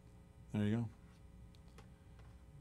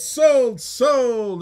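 loud, high auction call; calling out sold; 8 semitones higher, 8 dB louder than usual. Real, not acted. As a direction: shout it like closing a sale.

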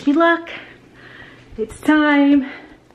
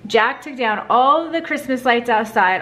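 ordinary talk

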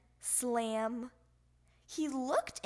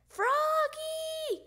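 long, high, loud call; father summoning his child; 5 dB louder than usual. A narrator's reading. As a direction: holler the name as if across the house. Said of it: "called his father".

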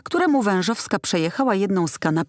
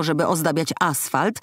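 ordinary delivery, nothing marked

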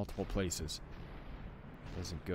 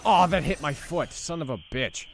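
loud outraged yelp; angry gamer outburst; raging gamer; 8 semitones higher, 8 dB louder than usual. Real, not acted. Hit by bullet.

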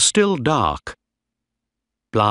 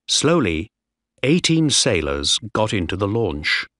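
ordinary delivery, nothing marked

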